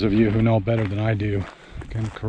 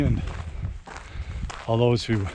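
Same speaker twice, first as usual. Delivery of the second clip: ordinary talk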